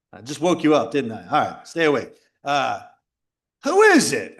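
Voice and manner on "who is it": higher, louder, commanding "Who is it?"; authoritative challenge. Retold or imitated. Homeowner, to the banging at his door, commanding. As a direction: take charge of your own doorway. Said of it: "I had to take authority over it".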